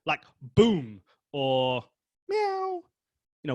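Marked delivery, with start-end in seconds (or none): 0.0-1.8 a slow booming boom; boom said big. Shown as a demonstration. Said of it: "words that sound like they actually are". 2.3-2.8 high cat mew; cat meow. Shown as a demonstration. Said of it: "words that sound like they actually are".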